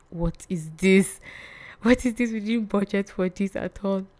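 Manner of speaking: mock alarm, voice jumping up on the second what; shocked disbelief.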